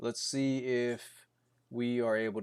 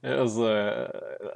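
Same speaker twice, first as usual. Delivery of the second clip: ordinary talk, nothing marked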